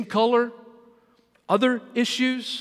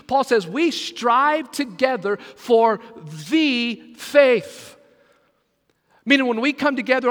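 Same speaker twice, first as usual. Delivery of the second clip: louder, driving sermon delivery; preaching; 3 semitones higher, 5 dB louder than usual. Acted. Preacher urging the church about the faith, loud and driving. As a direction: press the point home to the congregation.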